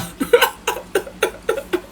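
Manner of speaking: airless high wheeze of laughter; cracking up mid-sentence; breathy laugh. Real, not acted. Cracks up before story.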